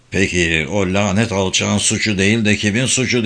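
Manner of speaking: usual speaking voice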